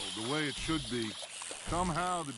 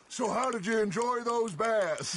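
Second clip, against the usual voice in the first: imitating a fishmonger's hearty call, raised pitch; louder, higher quoted call across a market; 5 semitones higher, 4 dB louder than usual. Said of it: "Fishmonger calls out".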